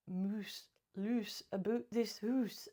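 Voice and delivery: slow, hammed-up Scottish accent. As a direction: put on a jokey Scottish accent, drawing it out. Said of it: "me doing a Scottish accent".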